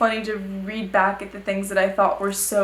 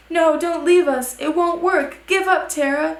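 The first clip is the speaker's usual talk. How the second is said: higher, louder crowd pleading; acted shout; 7 semitones higher, 4 dB louder than usual. A narrator's reading. Everyone shouting after Tara as she runs off, acted shout. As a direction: read it as a mild shout, pitched up. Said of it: "Everyone shouts".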